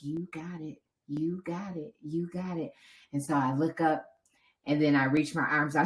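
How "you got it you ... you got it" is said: hushed, repeated urging, imitated; soft encouragement. Supporters urging runner stretching on the fence, imitated soft encouragement. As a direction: murmur support to someone who is spent.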